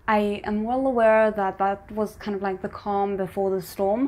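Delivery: usual speaking voice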